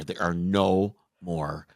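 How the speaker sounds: ordinary talk, nothing marked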